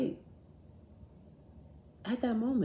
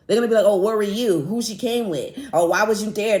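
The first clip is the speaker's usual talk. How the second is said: louder, a hammed-up chorus of people blaming her; mock accusers; 2 semitones higher, 5 dB louder than usual. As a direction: throw the questions like nosy accusers. Mocking imagined accusers.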